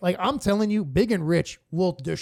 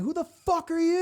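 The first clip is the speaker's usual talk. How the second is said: put-on drunk heckler; 11 semitones higher than usual. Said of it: "He's like".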